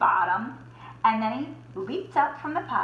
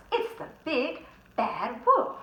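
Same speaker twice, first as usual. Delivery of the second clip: frightened cry; 5 semitones higher than usual. Acted. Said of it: "cried Horace".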